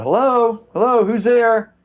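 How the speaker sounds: high, loud nervous call into the dark. Retold or imitated. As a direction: call out to an intruder you can't see. Calling out into dark house.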